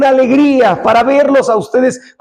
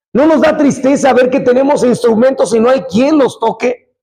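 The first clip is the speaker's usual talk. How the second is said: normal talking voice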